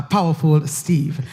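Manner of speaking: usual speaking voice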